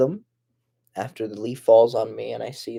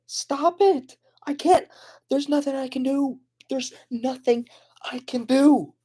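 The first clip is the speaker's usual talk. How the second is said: much higher, desperate whining voice; high mock wail. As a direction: whine it out helplessly, then fade to a whisper. Sudden high wailing panic.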